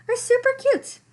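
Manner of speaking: very high squeaky clown voice. A narrator's reading. Clowns raving about the sweater, squeaky high.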